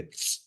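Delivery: Tss, sharp ejective burst; popped ts. Shown as a demonstration. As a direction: spit out Tss hard. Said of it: "you really pop it".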